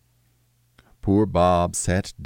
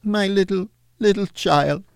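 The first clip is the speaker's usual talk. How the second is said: crying out; 12 semitones higher than usual. Acted. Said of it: "cried Bob".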